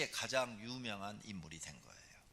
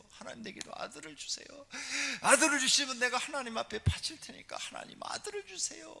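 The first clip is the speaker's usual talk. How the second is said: higher, rising plea to God; pleading prayer; 3 dB louder than usual. Retold or imitated. Hannah begging God for a son, pleading higher. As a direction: beg for a son, voice lifting.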